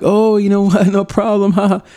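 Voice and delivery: high, laughing mock breezy tone; wishy-washy brush-off. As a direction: play the pushover who laughs it off. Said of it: "I wasn't wishy-washy".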